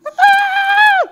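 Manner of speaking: high loud frightened scream; terrified scream. Acted. Mouse shutting its eyes before the lion, screaming high. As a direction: shriek with eyes shut tight.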